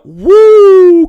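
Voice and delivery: a high, loud whoop; loud cheer. Real, not acted. Streamer whoops for a South Florida viewer, high and loud.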